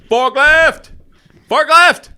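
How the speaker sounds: high, loud yelling twice; repeated yell. Retold or imitated. Costco worker yelling at shoppers, loud repeated yell. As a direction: call it out across the store. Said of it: "he was yelling".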